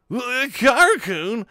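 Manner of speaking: high, loud, slow alarmed question; a flustered high yelp of a name; startled question. Acted. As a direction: caught off guard by the remark. Shinji startled by Karu.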